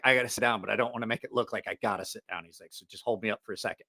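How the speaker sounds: low, soft, quick aside; retold furtive confiding. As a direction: quietly imitate someone hiding that he's hurt. Hurt Mikey confides, hiding weakness.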